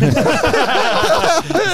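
sharp high cackle; high laugh. Real, not acted. Laugh at own joke.

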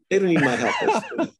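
amused, chuckling as you speak; laughing. Real, not acted. Laughing at online critics.

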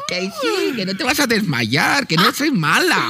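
put-on high, creaky old hag offering an apple; sweet-talking evil witch, pitch way up. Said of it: "qué bruja".

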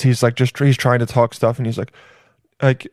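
ordinary talk

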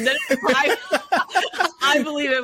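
laughing. Real, not acted. Laughing at her cat.

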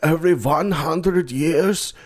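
high, slow mock German accent; hammed-up German prophecy, very drawn out; old scary German guy. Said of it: "this old scary German guy".

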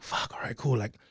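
breathy unvoiced fuck all right; muttered to himself, half giving in; whispered resignation. To himself, deciding to drive anyway, unvoiced mutter. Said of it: "But I was like".

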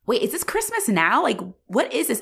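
high-pitched mock confusion; retold bafflement. Viewer reacting to a confusing advent calendar, baffled. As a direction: ask it high, in exaggerated disbelief.

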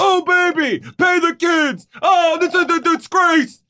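high shouted hammed-up impression; over-the-top shouted mimicry of a podcast host; Tate doing an impression. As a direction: yell it like Tate hamming up a bit. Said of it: "I can do an impression of Tate doing an impression".

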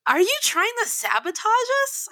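high-pitched incredulous question; re-enacted suspicious interrogation; mock accusation. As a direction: accuse him in pointed disbelief. Confronting the sound guy accusingly.

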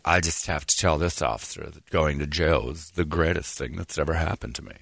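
low, soft mock-earnest quote; lightly voiced low, subdued testimony; reformed man's testimony. Reformed man telling the news about jail, lightly imitated, low and subdued.

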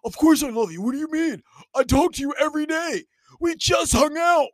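high, loud, flustered protest; mock defensive boyfriend. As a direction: protest like a clueless guy caught off guard. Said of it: "dumbass guys who are like".

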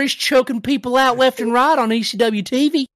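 high, loud wrestling-promo outburst; mock promo holler. Host defending the choke on wrestling TV, loud mock promo.

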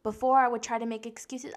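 ordinary talk

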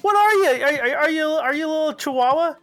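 high, loud and fired up; loud amped-up basketball hype; hyped-up. Shown as a demonstration. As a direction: hype the dog in them like a pumped fan. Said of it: "give me that intonation".

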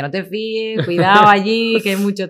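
high, loud mock-motherly warning; mother's warning. Mother warning her daughter about men, high and insistent. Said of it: "mi madre siempre me decía".

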